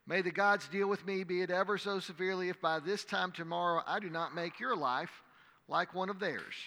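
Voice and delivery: measured, dramatic Bible reading to a congregation; scripture reading. Acted. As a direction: read the passage aloud like a preacher. Reads the scripture passage.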